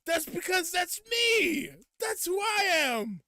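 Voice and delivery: high, drawn-out mock-triumphant yell; hammed-up bragging at full volume; triumphant shout. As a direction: shout it proudly, stretching every word. Imagined triumphant reply to fans.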